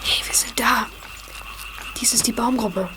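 soft unvoiced whisper. Boy hiding in the moor whispers to friends. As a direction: keep it to a breath.

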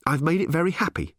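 high, irritated snap; snapped retort. A narrator's reading. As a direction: snap back defiantly. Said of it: "snapped Ford".

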